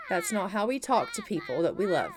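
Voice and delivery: normal talking voice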